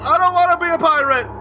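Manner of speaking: high whiny shout; Seinfeld yell. Retold or imitated. Quoting Seinfeld's puffy shirt episode, high whiny shout. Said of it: "where Seinfeld yells".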